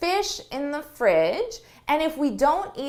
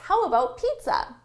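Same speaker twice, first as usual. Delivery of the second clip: suggestion tone; 6 semitones higher than usual. Shown as a demonstration. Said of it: "You can kind of hear it in my tone of voice".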